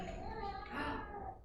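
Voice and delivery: big breathy storytelling gasp; mock gasp. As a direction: gasp in pretend shock for the kids. Reacting to the refusal.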